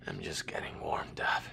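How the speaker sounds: wounded, barely voiced; faint injured whisper; weak whisper. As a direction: breathe the words out in pain. Wounded soldier talking weakly.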